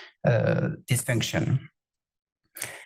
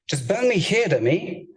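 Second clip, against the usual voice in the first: mock-bossy heart giving an order; playful heart voice; 7 semitones higher than usual. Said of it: "you can talk to your heart".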